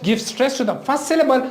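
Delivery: ordinary talk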